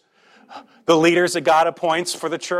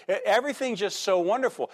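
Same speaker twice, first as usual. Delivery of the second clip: quick mock nostalgia; 6 semitones higher, faster than usual. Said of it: "when we get in the middle of the hardship".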